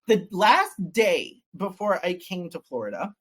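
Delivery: ordinary talk, nothing marked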